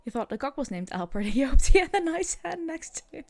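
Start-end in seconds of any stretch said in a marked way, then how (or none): 1.3-3.2 higher, louder, breaking up with laughter; laughing. Real, not acted. Streamer reacting to a chat joke about Albert, laughing. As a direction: crack up while getting the joke out.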